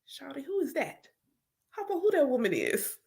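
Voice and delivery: quiet, startled asking; hushed disbelief. Retold or imitated. Waking from a dream, softly asking about herself. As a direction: murmur it like just woken. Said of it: "I wake up like".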